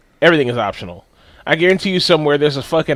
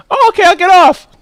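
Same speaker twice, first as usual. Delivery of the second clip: put-on little girl voice; 9 dB louder than usual. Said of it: "the girl's like".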